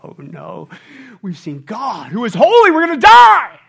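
building to a high loud cry of fear; screamed in terror. Acted. Manoah's family crying out before God. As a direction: scream that you'll die. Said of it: "They screamed out".

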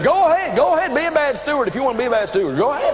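high, quick mock-indignant retort; exasperated retort. Husband answering his wife's shopping threat, exasperated.

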